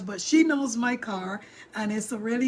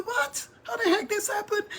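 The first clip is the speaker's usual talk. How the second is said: much higher, disbelieving; shocked mom; 11 semitones higher than usual. Retold or imitated. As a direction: react like you can't believe the news. Mom hearing of crash.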